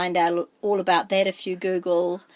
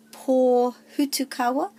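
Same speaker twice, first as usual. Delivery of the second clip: "pōhutukawa" higher, with a long pō; stress on PŌ; 5 semitones higher than usual. Shown as a demonstration. Teacher repeating the new name, stressed long pō in "pōhutukawa". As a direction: lean on the pō in "pōhutukawa". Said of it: "the pō has the emphasis".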